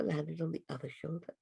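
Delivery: ordinary delivery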